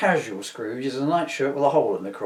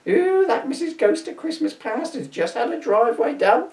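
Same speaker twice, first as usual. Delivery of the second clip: higher, louder nagging wife voice; 11 semitones higher, 4 dB louder than usual. Retold or imitated. Spirit mimicking his wife griping about the neighbours, nagging. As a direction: whine it like a jealous nagging wife. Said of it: "going on about".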